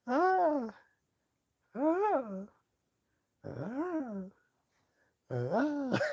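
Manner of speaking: high drawn-out wailing cries; mock howling wail. Mocking a grieving man's cries to the four directions, high wail. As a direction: wail it up to the sky, mocking him. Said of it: "yelled to the north".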